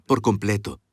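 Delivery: low, gentle fatherly forgiveness; a father holding his son's eyes; tender father to son. Shown as a demonstration. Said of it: "Vean a sus hijos a los ojos".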